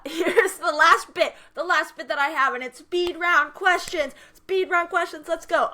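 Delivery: high, loud, stretched hype; excited announcement. Real, not acted. Announcing the speed round to her cohost, high and loud. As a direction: build it up like a game host.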